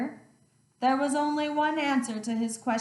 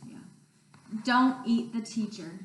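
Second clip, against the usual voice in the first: usual speaking voice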